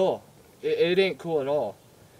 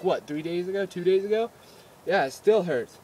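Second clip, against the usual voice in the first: normal talking voice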